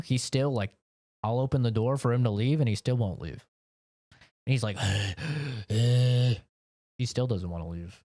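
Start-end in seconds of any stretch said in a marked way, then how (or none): 4.8-5.6 heaving mock gasp of a hot dog; imitated dog panting. Dog dying in the hot office, imitated panting. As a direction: pant like an overheated dog.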